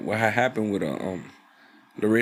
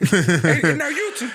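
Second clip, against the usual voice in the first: mock voice; 4 semitones higher, 5 dB louder than usual. Mocking voice, laughing.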